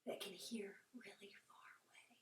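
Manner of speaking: soft hushed whisper. Shown as a demonstration. Teacher explaining owl ears to kids, whispering. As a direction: breathe it quietly.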